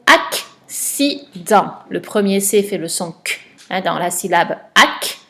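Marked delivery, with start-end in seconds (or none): 0.1-1.7 slow, a bit louder, word stretched; slow dictation. Shown as a demonstration. Teacher correcting an exercise with a pupil, slowly. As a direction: say it syllable by syllable. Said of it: "Le mot".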